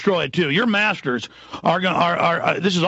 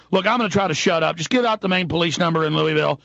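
ordinary talk

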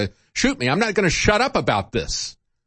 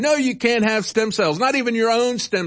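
higher, mock-scolding government voice; sarcastic mock; 7 semitones higher than usual. Host mocking officials who deny medicine, higher sarcastic scold. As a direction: parody the officials saying no.

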